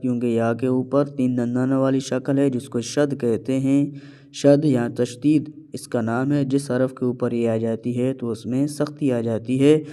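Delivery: normal talking voice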